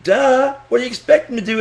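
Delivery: normal talking voice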